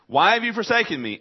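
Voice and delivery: a loud, high cry of abandonment; anguished cry. Acted. Repeated cry of Jesus.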